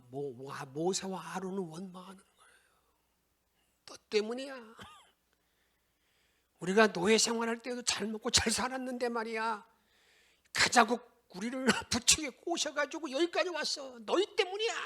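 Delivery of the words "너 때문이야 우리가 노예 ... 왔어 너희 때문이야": rising, louder mock accusation; hammed-up blame climbing to a high cry; blaming crowd. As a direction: point the finger, building higher. Preacher voices people blaming Moses.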